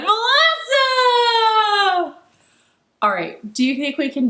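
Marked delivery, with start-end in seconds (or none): 0.0-2.1 very high, loud launch whoop; excited shout. Real, not acted. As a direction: shoot the voice up and hold it. Shouted end of rocket song.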